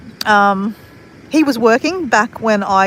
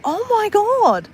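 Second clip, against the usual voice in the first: very high, drawn-out disbelief; retold amazement; 12 semitones higher, much slower than usual. Reading the friend's message, re-enacted amazement. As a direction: re-enact your slow, high-pitched amazement.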